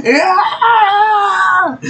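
very high shrieking mock yell through laughter; imitated scream. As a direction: shriek it high and loud, cracking up. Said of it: "I can see him going".

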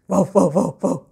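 short loud woofs; string of imitated barks; contented dog barks. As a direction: woof briskly after a feast. Dog barks after finishing meal.

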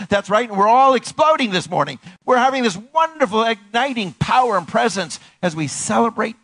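high, loud pulpit proclamation; forceful, celebratory exhortation; exultant preaching. Real, not acted.

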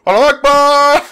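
high, loud hammed-up battle cry; mock suicide bomber yell. Creeper replacement sound idea, as a high hammed-up battle cry. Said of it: "the guy just going".

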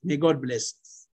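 ordinary talk, nothing marked

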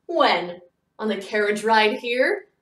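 a quick friendly jab at his friend; mock-doubtful retort. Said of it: "Mister Bendel retorted".

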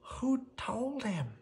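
soft, slow, high worried thought; Mooch's thought. Acted. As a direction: wonder quietly to yourself. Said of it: "thought Mooch".

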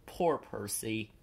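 usual speaking voice

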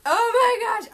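high loud oh my gosh; high excited gush; thrilled. Real, not acted. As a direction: gush with delight. Thrilled over awaited book.

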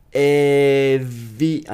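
slow "Every" with a buzzing V; V sound. Shown as a demonstration. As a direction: teeth on the lip, buzz the V. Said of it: "うに点々のV".

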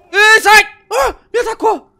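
panicked high shout; yelling while under attack; being chased. Real, not acted. Attacked in game.

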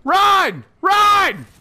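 urgent shouted warning; urgent call. Real, not acted. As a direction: yell for them to get moving. Urging teammates to flee.

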